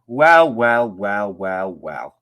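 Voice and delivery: loud knowing "well, well"; teasing "well, well". Real, not acted. Teasing exclamation.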